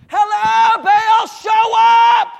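full-volume high scream to a god; drawn-out bellow, far above speaking pitch. Acted. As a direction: yell desperately for someone to appear. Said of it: "the people shouted louder".

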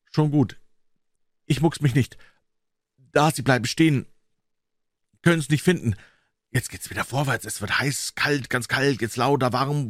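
put-on high boy's voice tracking danger; tense excitement. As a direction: call each step as the danger nears. Boys watch men coming nearer.

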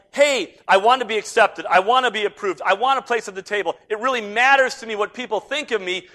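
loud, high plea for approval; needy, demanding attitude. Acted. Preacher voicing our wish to be accepted, loudly demanding. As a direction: push each want out forcefully. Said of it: "when we have this attitude like".

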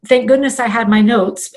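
ordinary delivery

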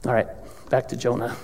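hushed aside; whisper. Real, not acted. Preacher whispering to congregation to return to topic. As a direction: drop to a whisper to steer back.

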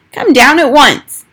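high, loud, slow grandmother's call. A narrator's reading. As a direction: call the boy down from below. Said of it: "she called out".

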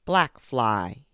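loud commanding call; bellow. Acted. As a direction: bellow the fly's name like a lion. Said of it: "he bellowed".

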